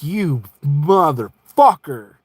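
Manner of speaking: slow, spaced-out curse; drawn-out outrage. Acted. Customer learning it takes batteries, slow outraged curse. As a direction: stretch each word in disbelief.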